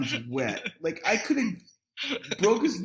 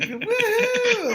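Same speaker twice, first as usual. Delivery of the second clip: put-on high party-girl whoop; excited woohoo. Imagined girls at the bonfire party, excited high whoop. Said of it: "like, chicks, like".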